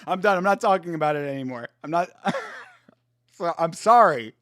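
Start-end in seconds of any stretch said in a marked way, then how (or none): 2.1-2.6 high squeal of laughter. Real, not acted. Laughing at donkey story.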